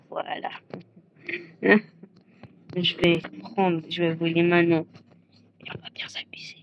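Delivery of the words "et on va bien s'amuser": soft scheming whisper; villain whispering his plan. Acted. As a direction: whisper it like a plot.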